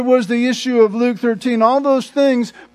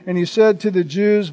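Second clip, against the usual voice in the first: normal talking voice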